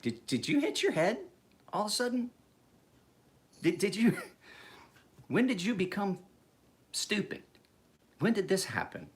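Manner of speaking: mock a daughter's sudden dumbness; exasperated parent. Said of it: "you look at her and you're like".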